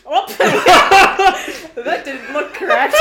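slow, loud, laughing through every word. Real, not acted. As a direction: crack up while saying it. Repeated while laughing hard.

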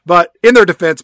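ordinary talk

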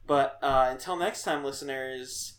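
normal talking voice

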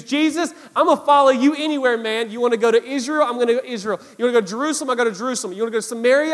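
loud, high, rapid-fire enthusiasm; eager pledge. Retold or imitated. Acting out Peter's eager pledge.

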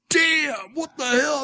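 high, drawn-out mock outcry; hammed-up disbelief, high and stretched; imitated shocked bystander. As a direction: gawp aloud like a startled onlooker. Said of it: "they always look up like".

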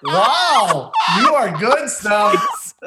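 high, rising cry of amazement; host praising the contestant, high and amazed; amazed praise. Real, not acted. Host marvels at the answer.

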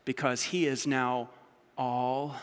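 ordinary talk, nothing marked

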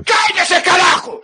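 furious shouted Spanish outburst; shaking with anger. Pretend. Mock outburst at co-host.

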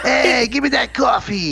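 put-on high, slow shouted New York accent. Host shows his own take, Italian mafioso New York accent. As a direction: call it out like a brash cartoon New Yorker. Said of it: "with my New York accent".

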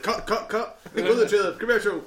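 high, loud comic panic; retold panicked shouting. Morning TV host halting a rude interview, panicked shout. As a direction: shout urgent orders over each other's heads.

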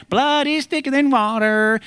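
high, strained horror-movie repeat; comic killer voice; mock villain. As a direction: ham up the proverb as a maniac.

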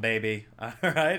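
ordinary delivery

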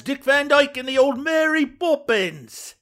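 playful over-the-top Mary Poppins impression. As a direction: ham up a music-hall Cockney to tease a friend. Teasing Liam about Cockney accents.

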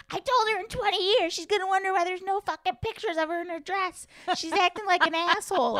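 put-on high exasperated mom, Chicago accent; thick Chicago accent. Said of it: "thick Chicago accent".

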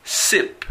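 "Sip" with a clean, hissed s; English word demo. Shown as a demonstration. Teacher reading the first word in the chart, careful demo. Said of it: "la primera columna, la primera fila".

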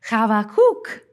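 Chavakuk with a throaty opening "ch"; attempted Hebrew pronunciation. Shown as a demonstration. Speaker attempts the researched name of Habakkuk, Hebrew pronunciation of Chavakuk. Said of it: "correct pronunciation might sound something like".

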